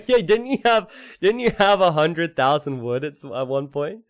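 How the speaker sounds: laughing, high and loud. Real, not acted. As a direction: talk through laughter. Laughing at the huge wood stockpile.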